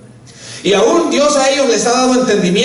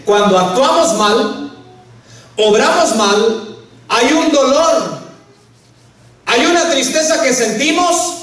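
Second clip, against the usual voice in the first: slower and deliberate, each phrase spaced out; slow, emphatic repeat; 4 semitones higher, slower than usual. Shown as a demonstration. Preacher repeating his point slowly to the congregation. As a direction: lay it out slowly so the congregation catches it. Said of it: "Lo voy a ir repitiendo".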